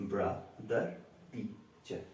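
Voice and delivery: ব্রাদার and টিচার each broken in two; short gaps inside ব্রা-দার and টি-চার; split into syllables. Shown as a demonstration. Teacher recapping two syllables, ব্রাদার and টিচার in separate beats. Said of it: "এখানে দুটো syllable আছে".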